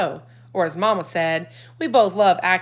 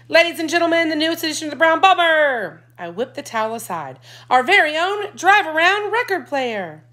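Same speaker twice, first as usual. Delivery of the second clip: high, loud grand announcement; showman announcer; 9 semitones higher, 5 dB louder than usual. A narrator's reading. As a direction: present it like a showman. Boy announcing the reveal.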